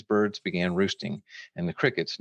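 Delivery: ordinary delivery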